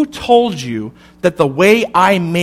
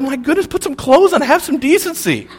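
higher, prim mock scolding, half laughing; mock prudish scolding; 7 semitones higher than usual. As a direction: play the scandalized neighbour lightly. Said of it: "and is like".

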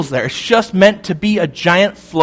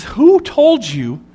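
slow, higher, searching question as God; 6 semitones higher, slower than usual. Acted. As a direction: draw out each word, gently probing. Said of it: "And God says".

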